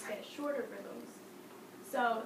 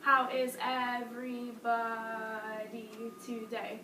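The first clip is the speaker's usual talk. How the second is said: swapped speech rhythm. Shown as a demonstration. Teacher mimicking real-life talk with funny rhythm, stretched. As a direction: say it with the long and short syllables reversed. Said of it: "said that in real life".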